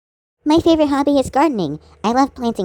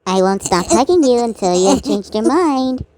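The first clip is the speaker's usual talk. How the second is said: normal talking voice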